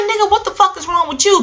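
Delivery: high, sharp mock outburst; angry friend. Friend yells at man, retold.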